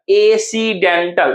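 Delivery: "एसीडेंटल" very slowly as ए-सी-डेन-टल; broken into syllables. Shown as a demonstration. Teacher splitting a word for students, slow syllables. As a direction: draw out every part of "एसीडेंटल". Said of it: "तोड़ के देखिए".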